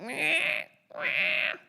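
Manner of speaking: high "meh" wail, badly faked; short mock-infant wails; imitated baby cry. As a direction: mimic a baby's bleating cry. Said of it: "You cried like this".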